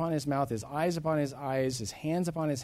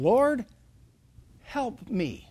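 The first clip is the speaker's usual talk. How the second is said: begging; 7 semitones higher, 5 dB louder, much slower than usual. Acted. Woman at Jesus' feet asks for help, begging. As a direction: plead for help, pausing after the first word. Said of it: "She's begging".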